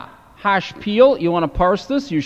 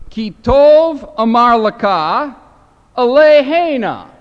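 declaiming Hebrew scripture for students; slow scripture reading; 7 semitones higher, 8 dB louder, slower than usual. Shown as a demonstration. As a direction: read the Hebrew verse slowly and loudly. Reads the verse aloud.

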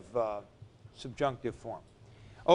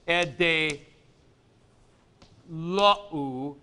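E de pause lo u, spaced out; syllables separated; slower than usual. Shown as a demonstration. Teacher showing how the accent moves, pausing. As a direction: pause after E de, then lo u. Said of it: "you're going like this".

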